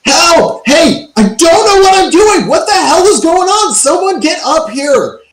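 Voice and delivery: high-pitched frantic yell for help; very high, alarmed cry; panicked screaming. Acted. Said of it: "just screaming".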